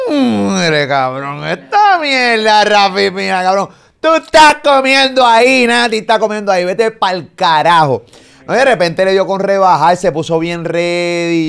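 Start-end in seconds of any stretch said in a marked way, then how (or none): 0.1-8.0 loud, high, drawn-out mock tirade at a friend; mock yell at Rafi. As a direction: bellow it like scolding a buddy.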